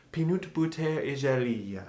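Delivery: playful French-accented English; exaggerated French accent. Shown as a demonstration. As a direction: say it like a French speaker. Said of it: "as the French accent people might say".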